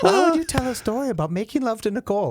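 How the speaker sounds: high-pitched mock mother, half laughing; imitating scandalised mom. Host imitating his mom objecting to the story, high mock scolding. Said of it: "She's like".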